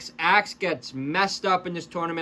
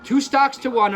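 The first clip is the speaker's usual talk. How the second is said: excited; 6 semitones higher, 6 dB louder than usual. Real, not acted. Excited commentary call.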